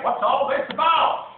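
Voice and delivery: high, loud amazed call; crowd shouting. Acted. Circus crowd shouts at the strange hatchling, loud amazed call. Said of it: "the people came shouting".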